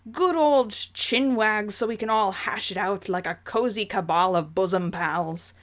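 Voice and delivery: hammed-up English gentleman; old-chap English accent, earnestly placating; Jake's fake English accent. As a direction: put on a fake English accent, coaxing and chummy. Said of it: "let's do that fake English accent justice".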